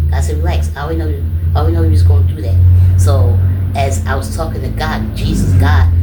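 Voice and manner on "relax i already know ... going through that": voicing God. God speaking, retold dream.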